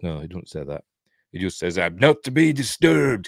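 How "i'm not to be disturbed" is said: undisguised officer. Acted. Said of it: "'cause he's not speaking in Russian".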